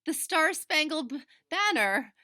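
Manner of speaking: high, loud, gasping through big laughs; gasping with laughter. A narrator's reading. Big sister laughing at little sister's mistake, gasping. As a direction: choke the words out between shrieks of laughter. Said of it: "she gasped".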